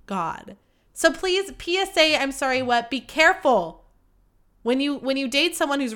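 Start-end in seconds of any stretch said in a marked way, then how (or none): none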